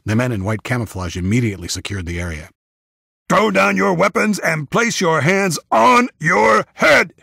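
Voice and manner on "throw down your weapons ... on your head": loud, high, drawn-out command; slow, forceful shouted demand; gruff yelled order. A narrator's reading. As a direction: yell it across the yard like a soldier. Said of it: "yelled a gruff voice".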